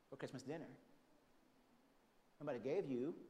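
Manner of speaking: usual speaking voice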